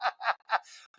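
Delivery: high, laughing through the words; can't stop giggling while being made up; squeaky giggle fit; giggling teen. Retold or imitated. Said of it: "And Bobby's like".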